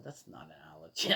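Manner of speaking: fast, breathy under-the-breath retort; quick whispered disagreement; muttered aside. Retold or imitated. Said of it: "And I'm going".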